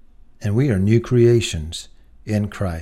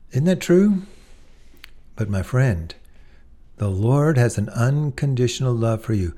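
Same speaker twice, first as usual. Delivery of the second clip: ordinary talk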